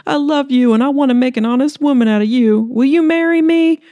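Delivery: the injured man pleads for her hand, muffled and high; Bub's proposal. Acted. Bub proposes through oxygen mask.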